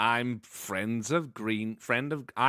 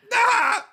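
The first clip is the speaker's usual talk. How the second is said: goat greeting yell; 9 dB louder than usual. Acted. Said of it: "greet each other like goats".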